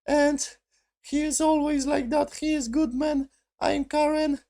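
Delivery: very high put-on mocking woman's voice; high joke voice mocking the villain; silly Karen voice. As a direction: squeak it out like a cartoon Karen. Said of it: "Stupid jokes with the Karen".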